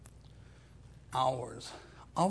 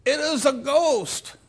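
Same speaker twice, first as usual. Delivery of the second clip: high, loud terrified cry of the disciples; frightened cry; 10 semitones higher, 8 dB louder than usual. Retold or imitated. Disciples on the stormy lake crying out in terror. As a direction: cry out in fear at a ghost. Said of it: "What do they cry out?".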